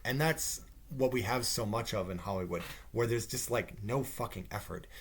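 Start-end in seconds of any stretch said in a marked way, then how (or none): none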